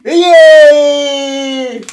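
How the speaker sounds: loud high cheer; excited cheer. Real, not acted. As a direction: cheer him in loudly. Cheering the new guest.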